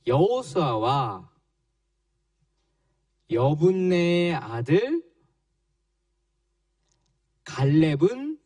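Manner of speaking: slow, word-by-word reading with long pauses; slow group reading. Real, not acted. Children reading a Bible verse aloud together, slow and halting. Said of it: "우리 친구들 너무너무 잘 읽었어요".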